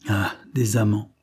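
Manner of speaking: ordinary talk, nothing marked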